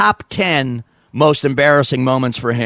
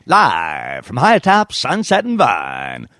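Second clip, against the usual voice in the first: big, slow radio station announcer; radio jingle; much slower than usual. Retold or imitated. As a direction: swoop through it like a station ID. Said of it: "Then he'd play the jingle".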